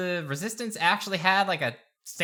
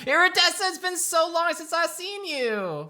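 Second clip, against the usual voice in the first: high, slow, sugary greeting; high sweet princess; 13 semitones higher than usual. Acted. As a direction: greet an old friend sweetly. Old friend greets princess.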